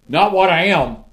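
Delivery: high, loud burst on the contrast; emphatic exclamation. Real, not acted. Preacher to congregation about his past, a loud emphatic cry. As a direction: punch it out.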